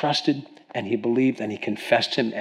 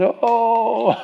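near tears; 9 semitones higher than usual. Retold or imitated. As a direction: re-enact a tearful, overwhelmed "oh". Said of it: "I wanted to cry".

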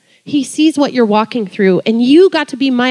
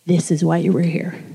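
quiet voice of God; 5 semitones lower, 6 dB quieter than usual. Retold or imitated. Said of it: "I felt God say to me".

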